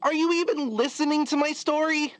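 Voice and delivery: annoyed. Acted. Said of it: "Why are you getting mad at me".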